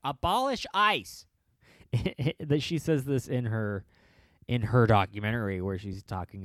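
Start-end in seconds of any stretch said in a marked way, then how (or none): 0.0-1.3 slow, high chanted slogan; mock rally chant, high and slow. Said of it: "just says catchphrase, like".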